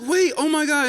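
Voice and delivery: high-pitched panicky plea; retold panic. Host watching the date flee, high-pitched retold panic. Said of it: "And so I'm panicking".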